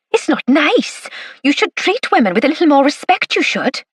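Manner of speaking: "you should" tagged on in Valleys Welsh; broad Valleys accent. Acted. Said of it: "in Nellie's broad Valleys accent".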